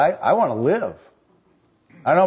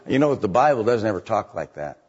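ordinary talk

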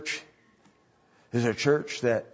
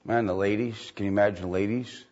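ordinary delivery

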